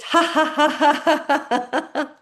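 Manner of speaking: high, loud ha ha ha laughing; forced laughter. Shown as a demonstration. Teacher leads class in laughter exercise, high laughing. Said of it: "laugh it out".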